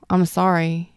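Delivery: ordinary talk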